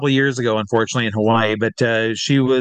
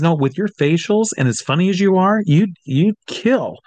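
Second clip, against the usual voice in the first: excited insistence; 7 semitones higher than usual. Retold or imitated. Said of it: "She says".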